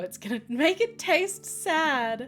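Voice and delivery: whiny daughter. Acted. Daughter whining about her burrito.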